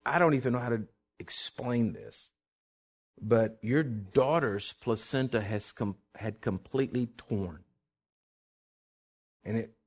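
slow, halting, hushed disbelief; lightly voiced baffled doctor, weighing each phrase; bewildered doctor. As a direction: retell astonishing news with long stunned pauses. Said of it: "got this bewildered look on her face".

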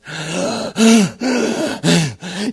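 loud, hammed-up panting; heaving mock-winded breaths; exhausted gasps. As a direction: gasp for air after a long run. Acting runner arriving out of breath.

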